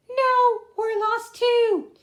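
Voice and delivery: very high squeaky bird voice; gull voice. Acted. Pipkin answering the gull in a high squeak. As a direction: pitch way up like a little bird. Gull's reply in story.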